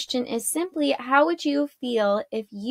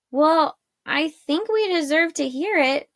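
higher-pitched, pointed demand; mildly impudent, raised pitch; rude insistence; 6 semitones higher than usual. A narrator's reading. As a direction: insist on an answer, a touch rudely. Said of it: "She hadn't meant to sound so rude".

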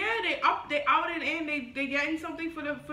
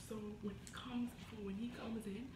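hushed whisper, much softer; 7 semitones lower, 15 dB quieter than usual. Real, not acted. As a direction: whisper so he won't hear. Hiding, whispering plan.

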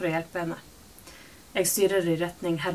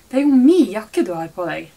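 louder, indignant demand; sharp loud claim; 2 semitones higher, 11 dB louder than usual. A narrator's reading. Mother demanding her jacket back from daughter, loud and indignant. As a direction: snap it out, louder, wanting it back.

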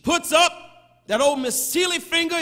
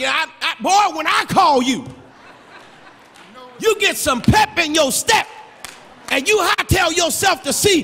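put-on loud, higher scolding shout at her boy; scolding mother; 6 semitones higher, 6 dB louder than usual. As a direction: yell at the boy to hurry up. Mother scolding a slow son.

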